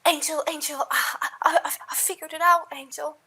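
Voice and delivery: high, eager fae creature voice; excited Trixie. Acted. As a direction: bubble over with excitement. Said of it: "friendly, uh, and very excited".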